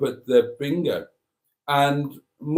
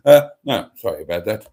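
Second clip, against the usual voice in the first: ordinary talk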